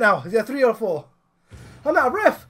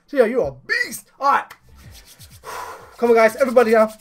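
normal talking voice

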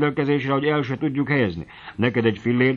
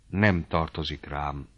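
soft, low and slow, barely voiced out; a quiet, withdrawn refusal, in a low, slow voice; said quietly; 6 semitones lower, 6 dB quieter, slower than usual. A narrator's reading. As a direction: Pista quietly turns down his uncle, low and slow. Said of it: "mondta csöndesen".

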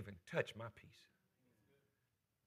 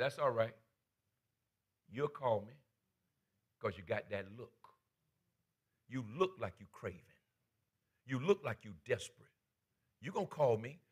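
quiet taunt; 4 semitones lower, 6 dB quieter than usual. Retold or imitated. Dealer voiced tempting Bobby.

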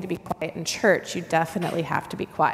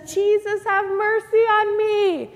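yelling again; 13 semitones higher, 7 dB louder than usual. Acted. Hushed blind man still calling to Jesus, high loud yell. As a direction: cry out over the hushing crowd. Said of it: "So again, he says".

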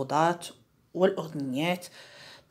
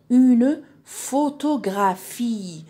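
very slow, higher, louder, stretched syllables; 4 semitones higher, 5 dB louder, much slower than usual. Shown as a demonstration. Teacher showing syllables in a picture word, syllable by syllable. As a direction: draw out each syllable for learners.

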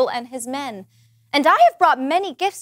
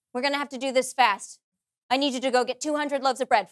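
ordinary talk

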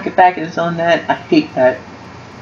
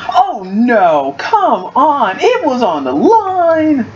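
frustrated outcry; 6 semitones higher, slower than usual. Real, not acted. Near miss in game.

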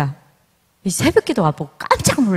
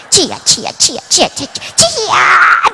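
from firm calls to a high, very slow, loud shout; building shouted 주여; 9 semitones higher, 6 dB louder, slower than usual. Retold or imitated. Rival mother outdoing her neighbour, crying 주여 louder and louder. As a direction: climb the 주여 calls up into a full shout. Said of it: "주여를 세 번 불러서 다섯 번 불러야지".

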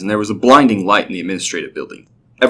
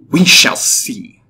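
slow, higher, deliberate threat; cold; 4 semitones higher, 4 dB louder, much slower than usual. A narrator's reading. Goddess answers the defiant mortal, cold. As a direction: draw it out coolly. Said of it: "she said coldly".

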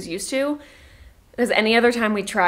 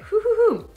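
steadying breaths; 12 semitones higher, 3 dB louder than usual. Real, not acted. Calming herself before crying.

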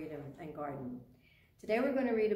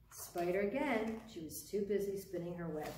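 plain reading voice

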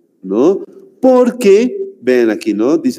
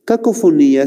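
usual speaking voice

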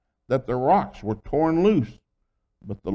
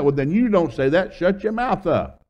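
louder, higher acted fatherly rebuke; scolding retort; 7 semitones higher, 4 dB louder than usual. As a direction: snap back like a fed-up father.

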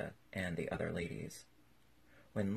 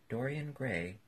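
plain reading voice

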